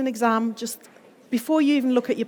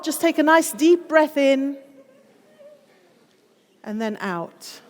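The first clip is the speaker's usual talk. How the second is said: guided breathing; 5 semitones higher, slower than usual. Shown as a demonstration. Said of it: "leads a breathing exercise".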